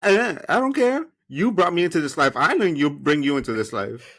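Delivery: a daughter refusing her mother, mock defiant. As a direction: talk back to mom playfully. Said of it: "playful defiance as daughter".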